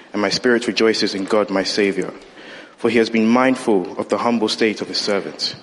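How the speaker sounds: ordinary talk, nothing marked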